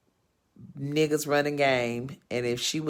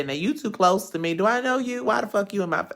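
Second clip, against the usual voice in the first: mama's rude challenge; 5 semitones higher, faster than usual. Retold or imitated. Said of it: "that's how she talks".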